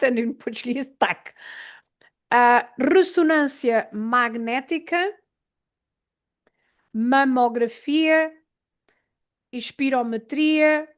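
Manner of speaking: normal talking voice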